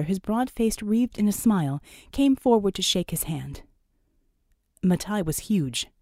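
ordinary delivery, nothing marked

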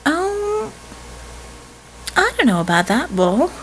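lazy drawl on a high Um. Acted. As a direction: stretch it out lazily, unbothered. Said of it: "Jeannie drawled".

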